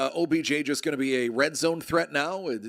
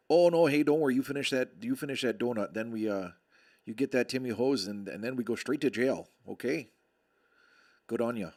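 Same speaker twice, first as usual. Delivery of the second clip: put-on Canadian accent; faster than usual. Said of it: "As only a Canadian could".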